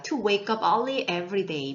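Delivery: slow, careful English reading; slow model reading. Shown as a demonstration. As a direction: read it out slowly for students to copy. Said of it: "이걸 제대로 한번 읽어보실게요".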